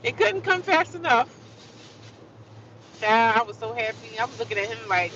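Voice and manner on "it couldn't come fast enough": high-pitched acted relief; hammed-up thankful outburst; exaggerated relief. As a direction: let the relief leap up high. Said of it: "so, so relieved".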